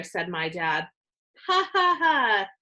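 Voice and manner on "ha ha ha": mock laugh. A narrator's reading. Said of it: "My sister Susie laughed".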